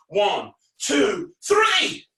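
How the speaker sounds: showman's rousing countdown; shouted count-in. Pretend. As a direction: build to a high, loud "three".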